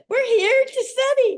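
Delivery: squeaky high, loud and bright-eyed; high eager plea. Retold or imitated. Young dancer answering the stern director, high and eager.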